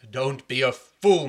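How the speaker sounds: loud, slow rebuke; king rebuking his jealous son; stern king. Acted. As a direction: scold him firmly. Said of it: "king scolds son".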